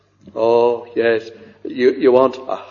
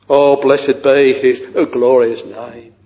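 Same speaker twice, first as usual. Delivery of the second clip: ordinary delivery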